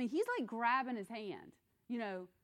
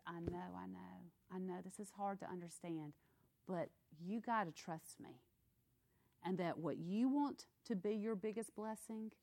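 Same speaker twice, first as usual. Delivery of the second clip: gentle God; 4 dB quieter than usual. Pretend.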